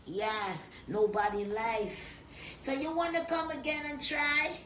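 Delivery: ordinary talk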